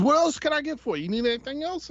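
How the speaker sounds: high Barbarino-style wise-guy voice; artist's voice. Retold or imitated. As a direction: ask it like a Brooklyn tough guy. Voicing the artist.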